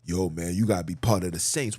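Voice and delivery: low, fast street-talk voice; a hint of a casual young brother; imitating the brothers. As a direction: toss it off quick and low like a brother on the block. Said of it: "I'm hearing brothers referring to each other as".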